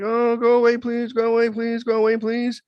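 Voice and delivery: high, loud, desperate begging; frightened repeated please; terrified pleading. Retold or imitated. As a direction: plead with the ghost in your room. Begging the ghost in the retold dream.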